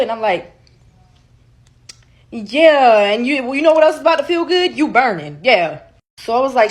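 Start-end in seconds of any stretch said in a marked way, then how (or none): 2.3-5.8 higher and louder, savoring the payback; gloating taunt. Retold or imitated. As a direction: rub it in his face. Taunting ex about infection.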